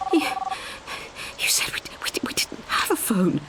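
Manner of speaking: breathy, halting whisper, words tripping over each other; frightened stammering whisper. A narrator's reading. Rosie stammers in the dark at Sheila, terrified whisper. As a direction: whisper it in shock, stumbling on the words. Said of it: "she stammered".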